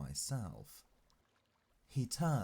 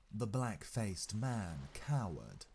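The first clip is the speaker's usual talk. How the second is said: ordinary delivery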